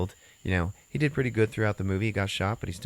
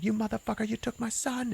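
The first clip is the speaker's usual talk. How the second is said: imitated tearful breakdown; 5 dB quieter than usual. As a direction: act out losing it over a lost son. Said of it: "just, like, loses it".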